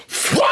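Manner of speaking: mock swooshing thud; shovel whack sound effect. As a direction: imitate the shovel hitting. Shovel hit noise.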